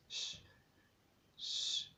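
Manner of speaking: soft unvoiced hiss; hissed shush. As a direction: hush them in a breathy hiss. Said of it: "hissed Professor McGonagall".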